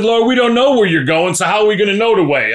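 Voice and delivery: high, loud, fast complaining disciple; exasperated Thomas. Retold or imitated. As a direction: whine it like a confused, fed-up Thomas. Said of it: "Thomas said".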